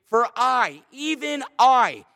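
"I" said as if with an exclamation mark; emphatic stress on I. Shown as a demonstration. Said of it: "it's emphatic".

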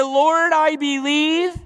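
high, loud, stretched-out plea; tearful cry out. Retold or imitated. Father of the sick child calls to Jesus, imitated drawn-out cry. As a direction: re-enact a father crying out, no real tears. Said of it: "he cried out and he said unto him with tears".

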